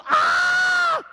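long, loud, high yell; wordless prayer cry. Acted. Someone who doesn't know what to pray, sustained loud cry. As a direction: let out a sustained cry for want of words. Acts out groan when words fail.

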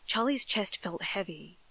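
usual speaking voice

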